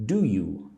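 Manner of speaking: "Do you" joined as one word; teacher demonstrating a linked "Do you"; run together. Shown as a demonstration. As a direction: link "Do you" with no gap between the words. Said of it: "Put do and you together".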